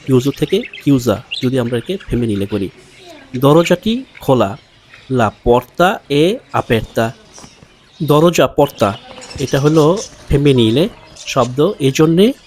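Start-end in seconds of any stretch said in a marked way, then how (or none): 5.1-7.1 very slow, each word laid out clearly; slow teacher demo; slow model reading. Shown as a demonstration. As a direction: spell the sentence out slowly for learners. Teacher models Italian sentence.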